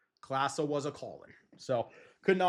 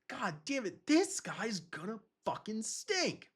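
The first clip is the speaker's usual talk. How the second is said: exasperated grumble; 8 semitones higher, 4 dB quieter than usual. Retold or imitated. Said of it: "he was like".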